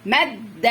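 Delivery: مد split on a doubled د, then open vowel; teacher holding the د in مد before releasing it; doubled-letter demo. Shown as a demonstration. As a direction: sound out مد in two parts. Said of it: "الميم بتاخد واحدة ساكنة من دي".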